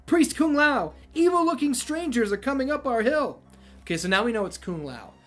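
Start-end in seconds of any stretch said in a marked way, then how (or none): 0.0-3.4 put-on high, loud, urgent boy's cry; breathless alarm. A narrator's reading. Young villager warns the priest that strangers are coming, breathless alarm. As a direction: call out a breathless warning. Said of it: "Breathless and wide-eyed".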